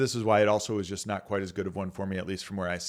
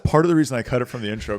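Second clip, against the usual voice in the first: ordinary delivery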